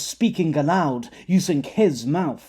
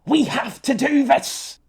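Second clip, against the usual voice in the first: desperate shout; 10 semitones higher than usual. Acted. Flicker desperate, pushing through pain.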